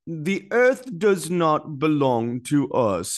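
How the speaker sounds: slow, hammed-up fake British accent; mock King Charles accent. Host mocking the king's speech in a slow fake British accent. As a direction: mimic a fake British royal accent, stretched out. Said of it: "the worst fake accent ever".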